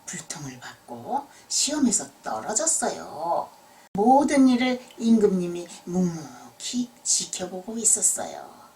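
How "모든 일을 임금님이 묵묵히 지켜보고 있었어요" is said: plain reading voice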